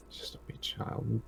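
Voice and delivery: put-on soft whisper, just a wee child; whispered plea. Character whispers to the group to spare the child. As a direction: whisper it, pleading for the boy.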